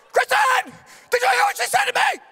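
high, loud indignant yell; husband shouting for his wife after the toddler insults him. Retold or imitated. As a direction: call her in, outraged. Yelling for wife in anger.

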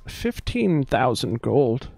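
mildly exasperated, stretch out each word; irritated. Acted. Barmaid naming her price to haggling customers, irritated. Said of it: "much more irritated, uh, tone".

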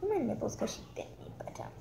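hushed, quick whisper; whispered aside. Real, not acted. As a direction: whisper it fast under your breath. Whispering aside about bite.

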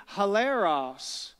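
hilaros said very slowly, syllable by syllable: hi-la-ros; slow Greek word. Shown as a demonstration. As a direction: draw out hilaros slowly so the congregation can repeat it. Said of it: "Say it with me".

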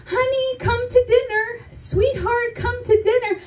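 high, singsong mock-sweet calling; sweet parental call. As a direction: coo it like a doting parent. Imitates friends' sweet parents calling.

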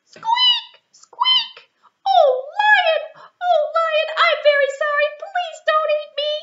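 very high, loud pleading squeak; frightened mouse squeak. Acted. Little mouse begging the lion not to eat her, very high squeak. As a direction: squeak and beg for your life. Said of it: "mouse pleads with lion".

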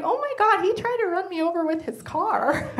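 high, thrilled mock squeal; excited. Telling girlfriend, playfully excited. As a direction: gush it like it's flattering. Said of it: "Then I got excited".

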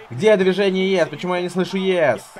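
loud rallying call. Acted. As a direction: call out to the crowd. Rallying crowd to chant.

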